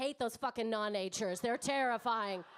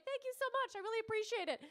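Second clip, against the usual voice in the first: much higher, gushing thanks; over-friendly thanks to the shop assistant; overly grateful; 9 semitones higher, faster than usual. Retold or imitated. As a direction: gush thanks like an eager Canadian. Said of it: "I was like".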